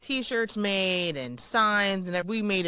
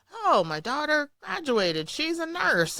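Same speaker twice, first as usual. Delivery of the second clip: proud father voice; 9 semitones higher, slower than usual. Retold or imitated. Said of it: "he was telling the people".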